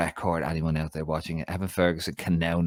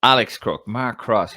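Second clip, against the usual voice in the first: ordinary talk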